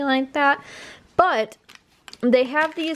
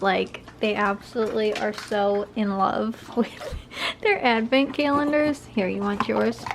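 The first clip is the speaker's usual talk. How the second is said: normal talking voice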